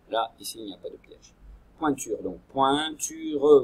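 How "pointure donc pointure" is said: Pointure drawn out slowly and loudly; slow clear model word. Shown as a demonstration. Teacher to pupils, modelling the word slowly. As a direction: spell out Pointure slowly for the class. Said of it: "Vous avez les trois syllabes".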